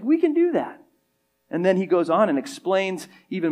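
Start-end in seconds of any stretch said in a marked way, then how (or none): 0.0-0.8 high, cocky we can do that; mock arrogant disciple; arrogant boast. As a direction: brag like overconfident disciples. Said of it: "sense the arrogance in them".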